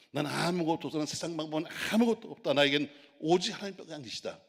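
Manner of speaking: loud, high, fervent cry; bold shout of faith. Acted. Young David shouting his faith at the giant, loud and high.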